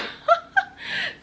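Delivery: loud, high burst of laughter; a delighted high laugh. Real, not acted. Laughs at the coincidence.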